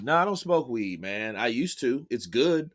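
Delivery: usual speaking voice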